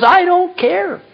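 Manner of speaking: high, drawn-out protest of indifference; emphatic dismissal. Acted. Mephibosheth answering the king about land, emphatic dismissal.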